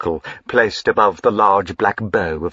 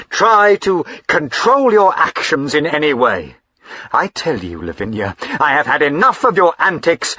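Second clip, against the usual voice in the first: violent anger; 6 semitones higher, 5 dB louder than usual. Acted. Husband scolding his wife for her antics, violent anger. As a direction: lay down the law in a fury. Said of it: "with a violence quite unlike his usual gentle politeness".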